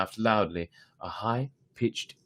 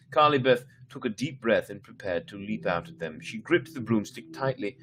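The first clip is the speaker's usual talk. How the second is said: ordinary delivery, nothing marked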